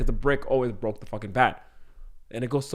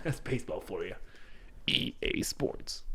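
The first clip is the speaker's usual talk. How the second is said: whispered ad tag; 11 dB quieter, slower than usual. Acted. Sports ad tag parody.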